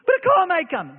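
mock fearful wife; frightened wife. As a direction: object like a scared wife.

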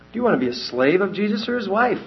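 ordinary talk, nothing marked

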